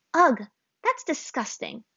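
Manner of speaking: disgusted. A narrator's reading. Azula recoils from the bison sneeze, disgusted. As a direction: recoil at the goo. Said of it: "Azula grimaced".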